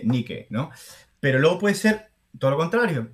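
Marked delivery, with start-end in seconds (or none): 0.0-0.5 "Nike" read as "NEE-keh"; two syllables, "Nike" as "nee-keh"; Spanish spelling pronunciation. Shown as a demonstration. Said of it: "si es español, ¿cómo se lee?".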